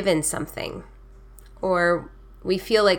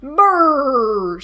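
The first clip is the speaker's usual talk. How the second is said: loud, high, drawn-out brrr; storybook shiver noise; 5 semitones higher, 5 dB louder than usual. A narrator's reading. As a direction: shudder out a cold brrr. Badger shivers in from cold.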